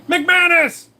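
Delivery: high, loud yelled name; high loud call; shouted name. Pretend. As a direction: bellow the name across the room. Yelling a character line.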